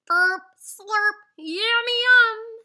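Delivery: high, loud, slow gobbling voice; hammed-up greedy eaters; greedy munching. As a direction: gobble it up gleefully. Sneaky eaters in dark.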